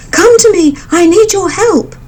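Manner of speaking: high, loud call to the forest. A narrator's reading. Mother bird calling the birds, high and loud. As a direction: summon your friends from far off. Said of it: "she called out".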